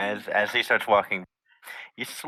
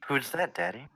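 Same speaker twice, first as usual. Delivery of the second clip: put-on higher, softer little-kid voice; soft child's voice; 5 semitones higher, 6 dB quieter than usual. Child asking Daddy who knocked, soft voice. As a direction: ask it softly, like a small child. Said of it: "you hear a very soft voice say".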